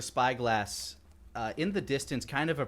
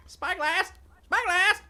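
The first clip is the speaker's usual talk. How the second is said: much higher, louder parrot squawk; 9 dB louder, slower than usual. Acted. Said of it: "speak for the parrot".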